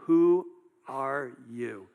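very slow, word-by-word challenge; drawn-out, deliberate demand; pointed questioning. Acted. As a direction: space out each word like an interrogator. Delegation confronting John with a question.

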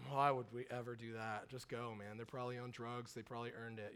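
put-on bored teen muttering objections; sulky teen. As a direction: grumble it like a slouching kid. Voicing the teen Josh.

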